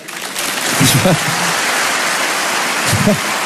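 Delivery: a short, breathy unvoiced laugh. Real, not acted. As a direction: laugh at your own joke. Laughing at his own joke. (petit rire soufflé)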